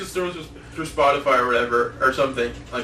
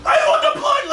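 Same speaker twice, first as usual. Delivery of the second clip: high, loud mock screaming through laughter; imitated yelling; 5 dB louder than usual. Imitating Max yelling his blender joke on stage, mock scream. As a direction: scream it like an over-hyped comic on stage. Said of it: "he starts yelling about a blender".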